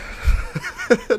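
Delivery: high breathless giggle; a helpless high laugh, out of breath. Real, not acted. Laughing after vow.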